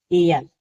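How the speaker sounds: "एयर" said firmly as a model English word; model word air. Shown as a demonstration. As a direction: land "एयर" as the example word. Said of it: "ए को ऐ ही पढ़ते हैं". (एयर शब्द का ज़ोरदार उच्चारण)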